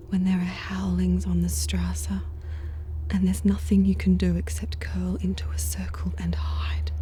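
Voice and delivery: hushed, unvoiced late-night whisper; eerie confiding whisper. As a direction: whisper as if the dark might hear.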